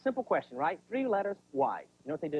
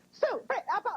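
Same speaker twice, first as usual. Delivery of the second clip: high, flustered mom sputtering names; stressed-out mom. Retold or imitated. Mom calling the wrong names, stressed high yelling. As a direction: fumble through names in high-pitched panic. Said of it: "especially when they're stressed out".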